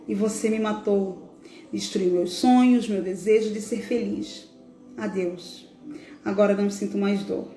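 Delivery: put-on heartfelt reading of a dead boy's farewell letter; a mournful poem read aloud over soft music; solemn recital. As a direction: recite it gently, as the lost child speaking to his father. Reads a reflection as Bernardo.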